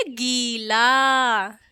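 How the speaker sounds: गीला stretched, "geee-laaa"; slow long-ee गीला. Shown as a demonstration. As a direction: draw out both vowels of गीला. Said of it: "बड़ी की मात्रा लगी है".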